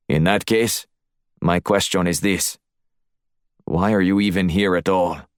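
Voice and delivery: put-on measured Russian-accented interrogation; questioning Russian accent. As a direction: press him as the Russian host. Voices the Russian host.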